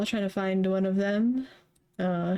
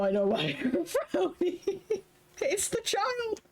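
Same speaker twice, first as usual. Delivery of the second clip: laughing; 11 semitones higher than usual. Acted. Character reacting, laughing.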